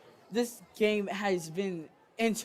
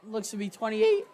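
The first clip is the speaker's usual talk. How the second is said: voice crack. Real, not acted. Announcer calling the yard line, voice cracking. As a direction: voice cracking upward on the last word. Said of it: "that voice crack there".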